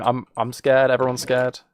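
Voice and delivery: ordinary talk, nothing marked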